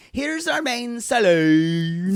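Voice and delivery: slow, high Irish drawl. Retold or imitated. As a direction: stretch it out in a thick Irish drawl. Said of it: "Such an Irish drawl".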